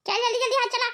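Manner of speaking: ordinary delivery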